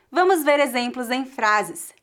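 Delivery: ordinary talk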